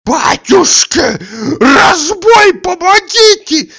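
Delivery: very high, wailing shriek; screaming for help. A narrator's reading. Tarantiev crying robbery to the street, shrieking alarm. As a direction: scream it at full force, drawn out. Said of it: "кричал Тарантьев".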